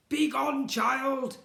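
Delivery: put-on high, loud snarl at the boy; nasty shout. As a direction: bark him away from the window. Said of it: "Edmund would shout".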